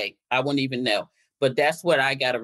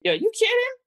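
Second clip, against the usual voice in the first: high-pitched incredulous question; retold disbelief. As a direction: act out being floored, voice leaping right up. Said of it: "mind-blowing to me".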